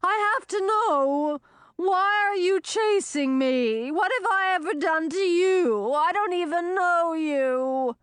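high, slow, pleading sea monster; a bewildered sea monster, drawn out; sea serpent. Acted.

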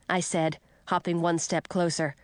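usual speaking voice

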